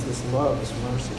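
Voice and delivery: usual speaking voice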